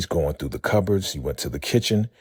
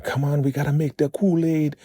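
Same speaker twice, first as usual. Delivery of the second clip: high bossy elder; 11 semitones higher than usual. Retold or imitated. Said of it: "She's like".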